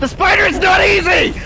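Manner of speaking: loud exasperated yell at the game; real frustrated gamer shout. Real, not acted.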